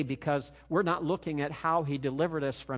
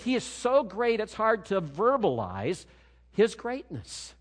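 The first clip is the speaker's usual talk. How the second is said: usual speaking voice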